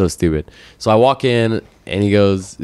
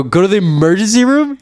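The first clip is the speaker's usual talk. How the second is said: high-pitched, disbelieving question; incredulous echo; 9 semitones higher, 4 dB louder than usual. Retold or imitated. Patient repeating the clerk's advice back to him, incredulous.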